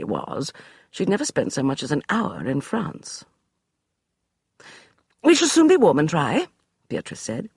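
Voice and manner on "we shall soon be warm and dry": high, brisk dismissal; imperious lady; commanding lady. Acted. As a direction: brush him aside like a general. Beatrice voiced.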